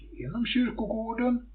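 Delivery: plain reading voice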